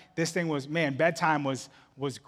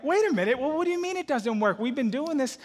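higher-pitched, incredulous protest; puzzled parent protesting, pitched up; imitated baffled dad; 8 semitones higher than usual. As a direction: push back in mock disbelief. Acts out his baffled reply.

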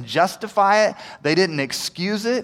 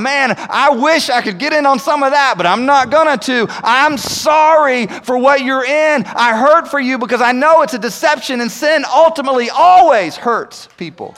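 loud, high, earnest voice of a caring believer; compassionate believer; 6 semitones higher, 6 dB louder than usual. Acted. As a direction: play the believer who hurts for the lost. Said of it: "We've gotta transition from".

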